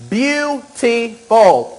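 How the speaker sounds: a long delighted beautiful; drawn-out admiring. Real, not acted. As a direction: savor the word like it's stunning. Admiring makeup result.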